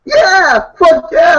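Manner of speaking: high, loud eager shout; excited yell. Real, not acted. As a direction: blurt it out, thrilled.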